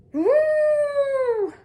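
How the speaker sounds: high, loud horn-like woo; hooting horn call; moose horn call. A narrator's reading. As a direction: blast it out like a horn. A loud horn-like sound heard.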